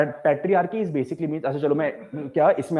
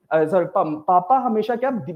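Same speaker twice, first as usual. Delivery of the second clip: ordinary talk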